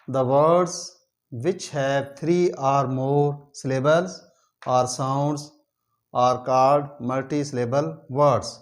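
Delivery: reads the English definition very slowly, word by word; बहुत धीरे, शब्द-शब्द पढ़कर समझाना; slow dictation. Shown as a demonstration. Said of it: "teacher reads definition slowly".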